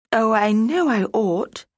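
high, slow anguished cry; distressed cry. Acted. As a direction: cry it out in despair. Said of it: "she cried".

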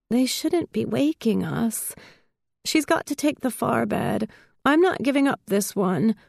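guard your bed, mildly offended; edge of indignation. A narrator's reading. Sleepy girl refusing to give up her bed, mildly indignant. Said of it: "now on the edge of indignation".

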